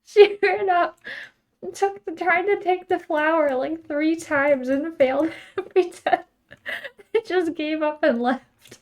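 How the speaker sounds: loud, high retelling through laughter; real laughter breaking into the words; amused, laughing. Real, not acted. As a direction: crack up while telling it, voice pitched high. Laughing at a game mishap.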